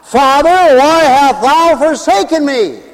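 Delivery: high, anguished cry from the cross; preacher's dramatic cry of abandonment. Acted. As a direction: cry out to a father who has left you. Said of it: "He even cried out from the cross".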